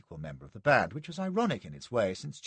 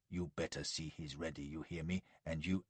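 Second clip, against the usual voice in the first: low, much softer, urgent furious whisper; 7 semitones lower, 11 dB quieter than usual. A narrator's reading. Joe thrusting his face close, furious whisper. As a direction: spit it quietly in his face. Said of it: "whispering furiously".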